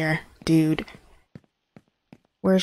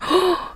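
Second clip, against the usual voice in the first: sharp gasp; 12 semitones higher than usual. Real, not acted. Jump scare in horror game.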